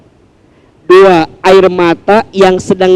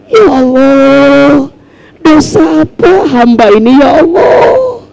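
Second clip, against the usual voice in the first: high pleading cry to Allah, voice near breaking; acted weeping prayer; tearful plea to God; 8 semitones higher than usual. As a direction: beg God like someone weeping over their sins. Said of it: "Gitu, kira-kira gitu".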